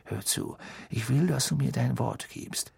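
softly urgent, under the voice; hushed and hasty. A narrator's reading. Mister Weasley asks Harry for his word, quietly urgent. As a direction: drop the volume, press for a promise. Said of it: "sprach leiser und hastiger".

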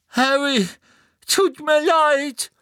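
anguished high shout, slow; Neville schreit. Acted. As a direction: cry out the apology in pain. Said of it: "schrie Neville". (Neville schreit gequält seine Entschuldigung)

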